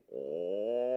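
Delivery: low, long droning whale moan; booming low whale song; whale call. Shown as a demonstration. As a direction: drone a deep, sustained "oh" like a whale. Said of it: "And the whales say".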